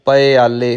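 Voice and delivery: ਪਿਆਲੇ said as "pa-i-aale"; open pappa. Shown as a demonstration. Teacher showing correct reading, ਪਿਆਲੇ opened out as "pa-i-aale". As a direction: keep a short a after the p in ਪਿਆਲੇ. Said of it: "ਪੱਪਾ ਮੁਕਤਾ ਰੱਖਣਾ ਹੈ".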